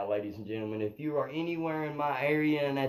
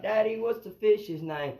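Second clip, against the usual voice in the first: higher, lightly voiced child asking Daddy; little girl asking; 9 semitones higher, 4 dB louder than usual. Daughter asks dad about the fish, imitated child voice. Father quotes his little girl's question.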